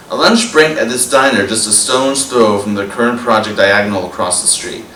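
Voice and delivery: normal talking voice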